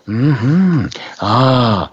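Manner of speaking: lightly voiced pensive doctor humming over a patient; a hint of a thoughtful doctor going mm-hmm; good doctor act. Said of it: "I was doing my good doctor act".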